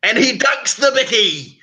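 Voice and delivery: high, drawn-out commentary call; excited Aussie commentator. Retold or imitated. Retelling the biscuit dunking video, excited Aussie commentary.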